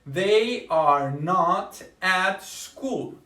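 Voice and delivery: very slow, word by word; slow model sentence. Shown as a demonstration. English teacher modeling the negative sentence slowly. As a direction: lay out every word for beginners. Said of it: "va a ir la forma negativa not".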